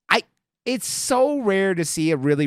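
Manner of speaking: ordinary talk, nothing marked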